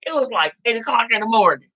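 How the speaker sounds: normal talking voice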